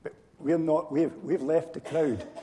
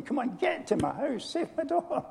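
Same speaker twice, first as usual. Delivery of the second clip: desperate plea; 10 semitones higher than usual. Retold or imitated. Father begging Jesus to hurry, rising plea. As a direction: pitch climbing high with urgency. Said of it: "It must have been".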